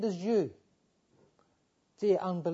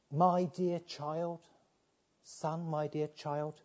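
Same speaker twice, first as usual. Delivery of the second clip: slow, soft and tender; gentle father's tenderness; compassionate; 5 dB quieter, much slower than usual. Acted. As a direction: linger on each word like comforting a child. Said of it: "notice the compassion of these words".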